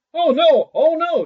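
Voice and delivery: very high, loud terrified cry; desperate shout. A narrator's reading. Sexton in the chest, crying out in terror to Little Claus. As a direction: shriek it like you are about to drown. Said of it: "shouted the sexton".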